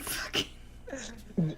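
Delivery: hushed frustrated whisper. Real, not acted. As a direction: whisper it in mock despair.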